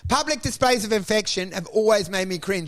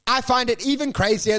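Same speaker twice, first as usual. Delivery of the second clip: usual speaking voice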